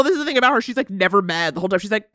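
ordinary talk, nothing marked

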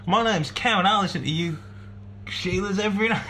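high, hammed-up Australian accent reading the email; mock Australian accent. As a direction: put on a jokey Australian accent, like an Aussie bloke. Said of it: "This isn't Australian, is it?".

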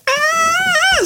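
very high excited squeal; fanboy squeal. Retold or imitated. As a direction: squeal like a starstruck fan. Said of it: "a little fanboy in mine".

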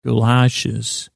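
normal talking voice

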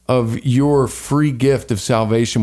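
usual speaking voice

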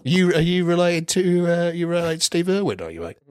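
playful hammed-up Australian accent. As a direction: quiz him like a nosy Aussie bloke. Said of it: "commitment to the Australian accent".

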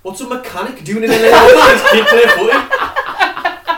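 loud, high-pitched laughing protest; incredulous outburst. Real, not acted. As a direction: cry out in disbelief, laughing. Joins in mocking the absurd plot.

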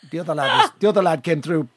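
usual speaking voice

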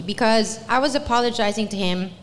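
usual speaking voice

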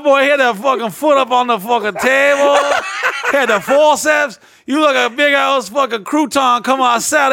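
high, loud Cajun dad ranting about the birth; imitating a loud Cajun father; dad's Cajun voice. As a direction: bellow it like an old Cajun dad. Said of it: "he's like".

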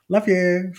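high, loud sing-song love you; breezy love you. Retold or imitated. Daughter calling out to parents, high sing-song. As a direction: toss it off in passing, sing-song. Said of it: "I'll walk through a room and be like".

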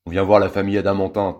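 loud, fast burly-man voice; booming brute. A narrator's reading. Burly man at the door announcing himself, booming. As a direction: thunder it out like a big bruiser. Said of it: "tonne un gros costaud".

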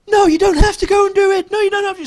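a loved one pleading with the hero in a hammed-up trailer scene; mock pleading. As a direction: beg them not to go, hammed-up melodrama. Mocking trailer heartstring dialogue.

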